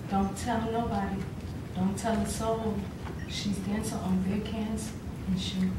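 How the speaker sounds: hushed secrecy. Acted. Performer in a choreopoem about a dancing girl, hushed. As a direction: confide it softly, with pauses.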